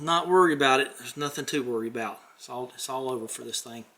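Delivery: usual speaking voice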